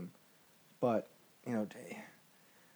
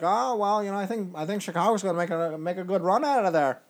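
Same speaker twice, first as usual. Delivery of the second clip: higher, louder mocking quote; mock analysts; 7 semitones higher, 7 dB louder than usual. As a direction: imitate pundits in a raised, hammy voice. Mocks analysts' predictions after "they're like.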